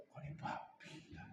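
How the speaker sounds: barely-voiced whisper of "babi lah", no force; limp whisper. Shown as a demonstration. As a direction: whisper "Kau ni babi lah" as if it means nothing. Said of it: "takkan kata". (bisikan lemah tanpa geram)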